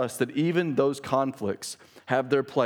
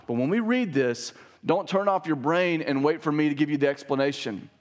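ordinary delivery

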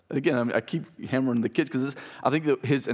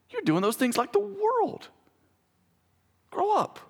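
much higher, urgent preaching rebuke; sharp rebuke. Acted. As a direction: drive the command home like a firm father.

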